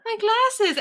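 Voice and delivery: high mock panic over lost glasses; panicked glasses cry. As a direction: yelp it high like a cartoon nerd. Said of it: "I went to Paul".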